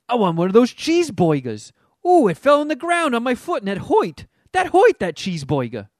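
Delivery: put-on jokey old Southern gangster voice with hoit for hurt; Will Smith's King Richard impression. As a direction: spoof the dad as a Southern old-timer turned mobster. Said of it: "kinda talks like an old Southern guy and then works in nineteen twenties gangsters".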